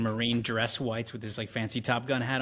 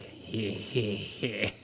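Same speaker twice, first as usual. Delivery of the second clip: awkward fake chuckle; 3 semitones lower, 5 dB quieter, much slower than usual. Major's awkward laugh.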